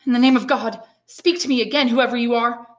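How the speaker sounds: loud, high urgent appeal; calling out. A narrator's reading. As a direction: call out to an unseen stranger. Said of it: "and he called out".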